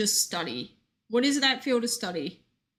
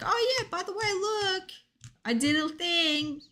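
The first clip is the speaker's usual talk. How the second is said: higher, offhand mock-casual announcement; casual reveal; 8 semitones higher than usual. Streamer imagining slipping news to friends, casual reveal. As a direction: drop it in like an afterthought. Rehearsing a casual brag to others.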